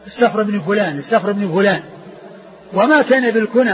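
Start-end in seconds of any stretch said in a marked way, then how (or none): 0.1-1.8 السفر said as Safr; f straight into r in السفر; sukun on the fa. Shown as a demonstration. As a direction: say السفر with no vowel after f. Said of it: "بإسكان الفاء".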